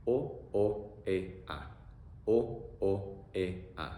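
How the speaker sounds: isolated vowels O O E A, sung out slowly; teacher drilling the vowel sounds; vowel sounds drilled. Shown as a demonstration. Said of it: "Pronunciation is also very simple".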